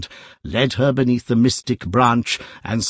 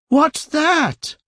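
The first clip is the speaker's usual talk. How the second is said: alarmed lady. A narrator's reading. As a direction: cry out in fright. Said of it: "said the middle-aged lady".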